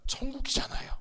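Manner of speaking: breathy hushed whisper of the word; soft whispered aside to the congregation. Acted. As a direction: lean in and whisper it like a secret. Preacher whispers heaven for effect.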